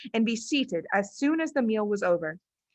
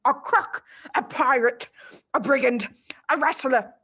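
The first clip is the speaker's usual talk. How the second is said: ranting headmistress; 4 semitones higher than usual. Acted. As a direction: hurl each name at him. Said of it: "the Trunchbull screamed".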